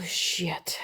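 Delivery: breathy hushed shit; whispered curse. Pretend. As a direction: whisper the swear under your breath. Whispered curse after moon voice.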